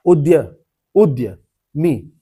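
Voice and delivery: usual speaking voice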